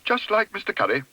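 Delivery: high-pitched indignant exclamation; exclaiming. A narrator's reading. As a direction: exclaim it high, disapproving of the neighbour. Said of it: "exclaimed Missus Bird".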